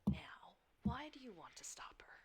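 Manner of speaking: unvoiced shadow whisper; dark whisper behind you. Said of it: "it's the Shadow's voice".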